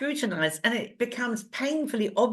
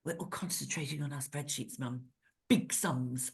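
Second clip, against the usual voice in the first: low, much softer hushed voice; hushed son; 6 semitones lower, 10 dB quieter than usual. Retold or imitated. Son telling mum on the phone, hushed murmur. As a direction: murmur so the office won't hear.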